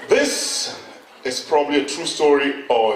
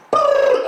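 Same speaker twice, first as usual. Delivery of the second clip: rushing noise. Retold or imitated. Rushing off to the job.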